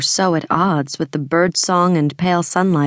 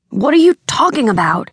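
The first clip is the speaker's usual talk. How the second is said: higher and louder, indignant protest; outraged; 8 semitones higher, 4 dB louder than usual. Acted. Brother denying to his sister, put-on outrage. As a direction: play the wronged brother, mock outrage. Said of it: "sounding just outraged enough".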